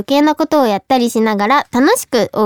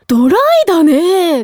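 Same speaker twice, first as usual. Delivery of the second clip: ドライだと評する; 6 semitones higher, slower than usual. Retold or imitated. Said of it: "言葉選んで言われた".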